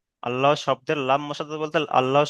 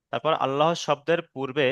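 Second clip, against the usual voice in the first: usual speaking voice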